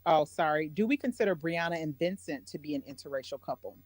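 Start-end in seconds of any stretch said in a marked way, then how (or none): none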